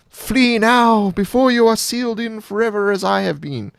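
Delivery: high, loud ghostly spirit warning; a child spirit urging them to flee, pitched high; sprite voice. Acted. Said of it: "you need to work on your sprite voice".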